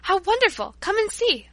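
high, loud cry of delight; excited exclaim. Acted. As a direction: call her over in wonder. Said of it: "she exclaimed".

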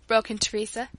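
ordinary delivery

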